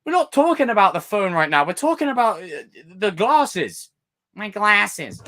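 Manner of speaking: theatrical exasperation. Pretend. Telling off someone who changed the subject, loud and high. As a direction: snap back, dramatically offended. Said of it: "I get very theatrical".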